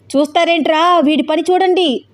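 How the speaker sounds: high pitched shout for help. A narrator's reading. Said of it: "అరుస్తున్నాడు దిలీప్".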